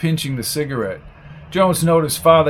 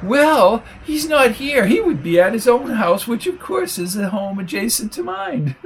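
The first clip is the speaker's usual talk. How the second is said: high squeaky prim lady; 7 semitones higher than usual. A narrator's reading. Flo's squeaky voice.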